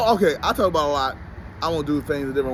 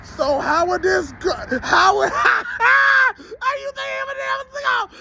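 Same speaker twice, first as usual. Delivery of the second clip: mock Martha Stewart; slower than usual. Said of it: "And she saying".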